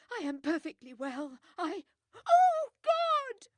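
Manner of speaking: fast, loud, rising to a high shriek; panicked protest into scream. Acted. Widow protesting to Poirot then screaming, panicked.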